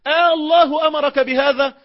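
mock-screamed high desperate question; a retold wife's frantic cry; high desperate plea. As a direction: cry it out like an abandoned mother. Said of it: "تصرخ وتقول له".

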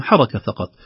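usual speaking voice

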